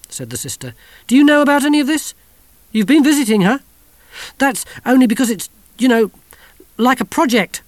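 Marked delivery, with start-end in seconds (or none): 1.1-3.6 high, loud, fast accusing questions; angry. Acted. Suspicious sister questions the children. 4.4-7.6 quick, raised excuse; defensive. Acted. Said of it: "said Kirsty defensively".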